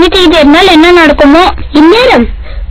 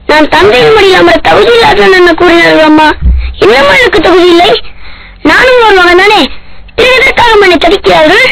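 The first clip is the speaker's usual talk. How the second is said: put-on a little boy's voice, higher, breaking into tears; crying child; 6 semitones higher than usual. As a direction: sob it out as a hurt son. Child sobs out his hurt.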